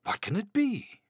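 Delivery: high, puzzled storybook voice; wondering Frog. A narrator's reading. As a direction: ask it in bewilderment. Frog puzzled by animals' warnings.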